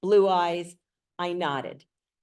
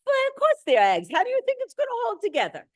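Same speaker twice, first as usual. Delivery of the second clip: put-on Eastern European accent; faster than usual. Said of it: "I do the worst Eastern European accent".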